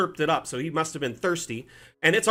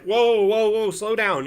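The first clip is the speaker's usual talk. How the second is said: raised, higher startled whoa to a charging dog; half-alarmed calling out, louder; 6 semitones higher, 4 dB louder, slower than usual. Retold or imitated. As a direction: call out to slow the big dog down.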